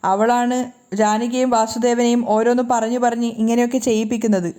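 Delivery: ordinary delivery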